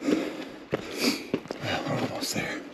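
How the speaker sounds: hushed whisper while sneaking up. Real, not acted. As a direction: breathe the words, barely voiced. Sneaking up on a cave.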